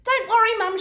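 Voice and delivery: high loud reassurance; cried out. A narrator's reading. Daughter reassuring mum, calling loudly. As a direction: call it out brightly. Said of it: "she cried".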